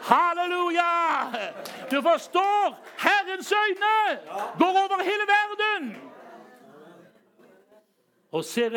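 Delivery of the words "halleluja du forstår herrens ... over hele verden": high, loud preacher's shout; full-force preaching. Real, not acted.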